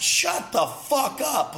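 slow, scornful put-down; slow dismissive. Real, not acted. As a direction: stretch each word to shut them down. Dismissing doubters mid-rant.

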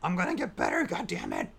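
hammed-up outburst in a put-on voice; a touch of imitated fury; mock angry vow. Podcaster re-enacting a TV character's vow, mock angry.

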